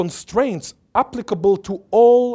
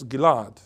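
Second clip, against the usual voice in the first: GILAD stressed as gi-LAD, falling; stress on last syllable; 4 semitones lower than usual. Shown as a demonstration. Lecturer showing where his name takes stress, final stress. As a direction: land firmly on LAD in Gilad. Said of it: "The only difference is the stress".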